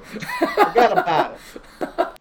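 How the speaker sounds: put-on slow, drawn-out New York accent. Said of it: "it's not very good".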